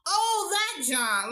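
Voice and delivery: high, drawn-out "oh, now I get it"; mock sudden realization. Acts out feigned sudden recall.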